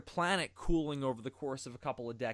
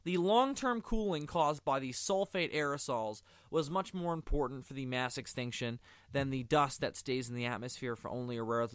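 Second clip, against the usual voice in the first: ordinary talk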